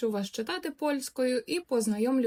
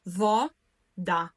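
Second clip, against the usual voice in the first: по складах; 3 semitones lower than usual. Shown as a demonstration. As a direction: split into two syllables: во-да. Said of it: "ділити слово на склади". (по складах: во-да)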